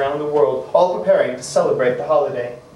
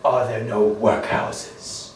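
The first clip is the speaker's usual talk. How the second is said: very slow, low ghostly voice; unearthly voice; 5 semitones lower, 4 dB quieter, much slower than usual. A narrator's reading. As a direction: drag it out like a cold spirit. Spirit mocks Scrooge's words.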